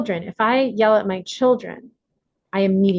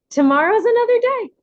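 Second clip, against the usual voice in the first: singsong encouragement; 13 semitones higher, 4 dB louder than usual. Pretend. Cheerful forgiving self-talk.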